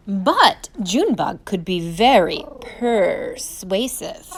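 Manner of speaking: ordinary delivery, nothing marked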